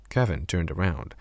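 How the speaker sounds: ordinary delivery, nothing marked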